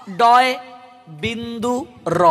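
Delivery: ডয়, বিন্দু, র slowly in separate words; careful separated ডয় বিন্দু র; correct alternative. Shown as a demonstration. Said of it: "কিংবা".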